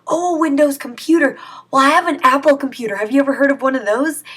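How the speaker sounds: dad pretending to be a clueless old man for scammers. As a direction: play a confused old man who knows nothing of computers. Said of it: "sounded like this old man".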